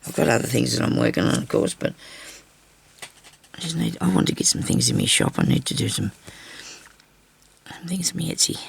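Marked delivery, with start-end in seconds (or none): none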